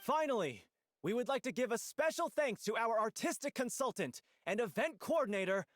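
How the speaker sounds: high, projected stage announcer; curtain-call announcement. Acted. Host thanks the event coordinator before the audience, proudly announcing. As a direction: announce it grandly to the whole audience.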